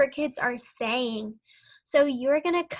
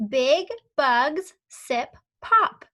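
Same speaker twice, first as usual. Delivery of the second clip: slow, louder word-by-word; slow and louder read-along; 6 dB louder, much slower than usual. Shown as a demonstration. Teacher reading together with pupils, slow and louder. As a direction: read slowly and loudly with them. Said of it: "read this with me".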